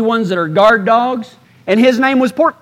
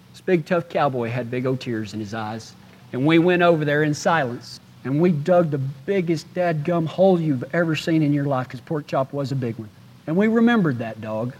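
much softer, heavy-hearted; hushed sorrow; 6 semitones lower, 9 dB quieter than usual. Real, not acted. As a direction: let the grief lower your voice. Sorrowful memory of burying dog.

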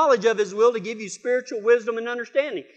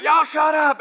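higher-pitched holler at the dogs; retold holler; 6 semitones higher, 3 dB louder than usual. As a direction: call out crossly to the dogs. Neighbor yells at dogs from porch.

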